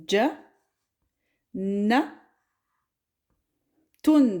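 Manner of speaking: Ja, Na, Tun split with pauses; syllable by syllable. Shown as a demonstration. Teacher spells out Jannatun syllable by syllable. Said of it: "Donc ici, on a ta marbuta".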